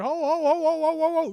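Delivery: high, loud calling for the ball; mock yell. Player yelling to teammates, loud high call. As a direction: shout it across the court. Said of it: "be like".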